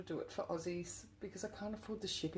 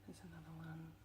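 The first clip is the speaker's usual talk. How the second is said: much softer, almost whispered; hushed; 15 dB quieter, faster than usual. Real, not acted. Hushed, to viewers, as she spots another key. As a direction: say it under your breath.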